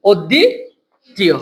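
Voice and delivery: very slow "অদ্বিতীয়", "দি" swooping up, pause, low "তীয়"; broken into syllables. Shown as a demonstration. Teacher showing the final sound, "অদ্বিতীয়" slowly pulled apart. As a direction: split "অদ্বিতীয়" after a long rising "দি", then let "তীয়" fall. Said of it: "অধ্বনি উচ্চারণ হয়েছে".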